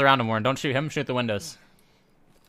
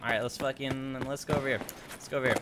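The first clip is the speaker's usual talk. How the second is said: ordinary talk, nothing marked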